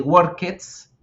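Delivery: "workets" as two syllables, "wor-kets"; wrong-way past of work, "workets". Shown as a demonstration. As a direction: sound a full "-ket" at the end of "workets". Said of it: "no voy a decir".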